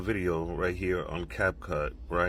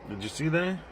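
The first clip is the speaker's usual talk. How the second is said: shocked; 9 semitones higher than usual. Real, not acted. Shocked at strange caption.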